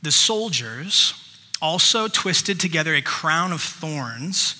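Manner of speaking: ordinary talk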